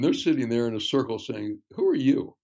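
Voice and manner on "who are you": higher, louder demanding question; suspicious challenge. Retold or imitated. Retelling distrustful leaders questioning him, higher and louder challenge.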